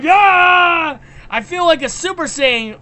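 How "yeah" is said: loud, high cheer; excited yeah. Retold or imitated. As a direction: whoop with excitement. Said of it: "And I'm like".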